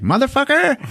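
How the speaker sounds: high cartoonish curse; mock villain yell. T-1000 prying the doors, high mock curse. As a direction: squeal it like a thwarted villain.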